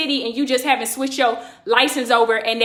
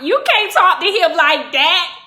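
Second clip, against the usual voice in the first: loud, slow, higher-pitched scolding; firm objection; 4 semitones higher, 5 dB louder than usual. Retold or imitated. Bystander telling the female cop off, slow loud objection. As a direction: spell it out loudly for the officer. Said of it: "I'm telling the female cop".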